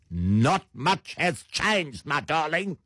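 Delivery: put-on high, affected Sandton socialite accent; rich Sandton accent. Catty advice to an ex-prisoner, rich Sandton accent. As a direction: play a rich Sandton house husband's accent. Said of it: "rich house husbands of Sandton".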